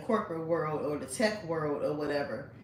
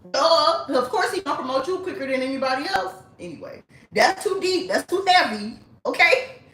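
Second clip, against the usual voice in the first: sassy; 9 semitones higher, 8 dB louder than usual. Real, not acted. Sassy aside to audience.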